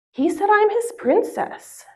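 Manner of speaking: high-pitched retold toddler answer; imitated little girl. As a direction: voice a three-year-old girl, high and drawn out. Mother voicing her small daughter's answer.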